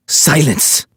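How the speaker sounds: put-on high, sharp bark in a thick Cuban accent. A narrator's reading. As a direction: snap it out like a Cuban guard. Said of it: "a man snapped with a thick Cuban accent".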